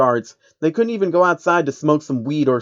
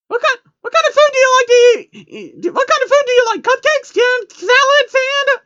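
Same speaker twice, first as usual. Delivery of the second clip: very high, squeaky, rushed babble; squeaky fast chatter; 6 dB louder than usual. Acted. Pinkie pelting the newcomer with questions, squeaky and high. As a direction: gush it out in a tiny squeal. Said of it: "Nobody could understand her like that".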